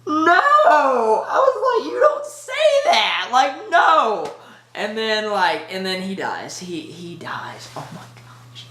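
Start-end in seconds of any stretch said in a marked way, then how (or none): 0.1-4.3 high-pitched crying protest, louder; anguished no. Retold or imitated. Retelling own reaction to Pepper. 6.6-8.7 hushed, slow whisper; whispered grief. Real, not acted. Reacting to the death.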